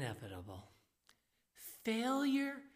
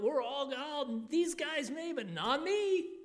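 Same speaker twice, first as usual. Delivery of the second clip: boastful disciple; 7 semitones higher, 4 dB louder than usual. Acted. Disciples arguing, voiced.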